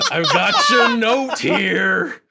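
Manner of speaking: high, slow, laughing creepy-stalker voice; spooky Michael Myers. Retold or imitated. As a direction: loom out of the fog, giggling, slow and menacing. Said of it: "like Michael Myers".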